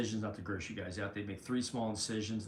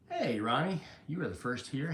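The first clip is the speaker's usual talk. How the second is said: ordinary delivery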